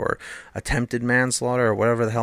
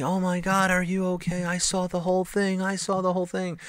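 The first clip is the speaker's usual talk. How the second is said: high-pitched imitation of fretting mothers; mock frantic mom voice, held high without shouting; panicked mothers; 8 semitones higher, 3 dB quieter than usual. Mothers rushing over to a hit kid, panicked. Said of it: "all these mothers screaming and rushing over".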